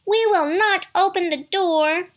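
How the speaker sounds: put-on high, loud kids' refusal; defiant cry. A narrator's reading. Little kids refuse the wolf at the door, crying out. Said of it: "they cried".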